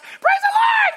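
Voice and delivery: very high squeaky shouted praise; tiny pebble voice shouting; preacher voicing little rocks squealing praise; little rocks' praise.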